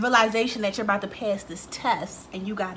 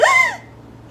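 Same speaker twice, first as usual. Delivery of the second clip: a big high gasp of shock; high astonished gasp; sharp gasp; 9 dB louder than usual. Retold or imitated. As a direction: gasp at the result on the paper. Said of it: "and I was like".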